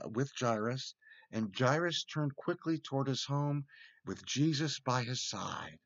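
plain reading voice

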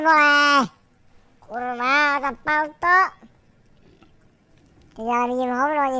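ordinary talk

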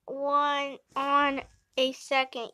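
usual speaking voice

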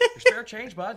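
a sudden high, loud rural Canadian jab; rural Canadian accent. Pretend. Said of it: "is it Newfoundland?".

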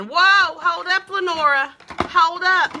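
mock alarmed viewer. Voicing viewers who balk at purple eyeshadow: hammed-up startled yell. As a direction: cry out like a startled fan stopping her. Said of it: "I know you're thinking".

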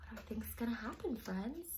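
fast breathless whisper full of suspense; hurried whisper. A narrator's reading. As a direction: rush the question in a secret whisper. Suspense question to children.

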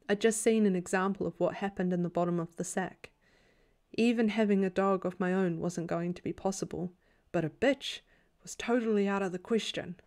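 ordinary delivery, nothing marked

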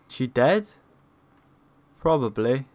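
normal talking voice

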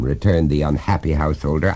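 ordinary delivery, nothing marked

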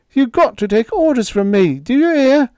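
high and loud, frantic; desperate command. A narrator's reading. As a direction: shout the order in panic. Higgins commanding robot desperately.

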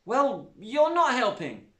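put-on a hurt girl shouting without turning round; shouted. A narrator's reading. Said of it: "shouted Zoe".

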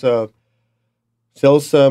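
ordinary talk

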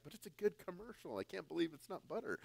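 high, soft and fast, spoofing a TV ad; mock commercial voice. Teasing his Kiwi friend with a soft, fast mock ad line. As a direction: rattle off the slogan like a quick, hushed ad read. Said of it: "I'm like".

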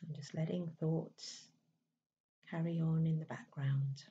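calm, unhurried meditation voice with long pauses; even, settled delivery in short, spaced phrases; guided meditation. Acted. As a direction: gently guide them inward, leaving space between phrases.

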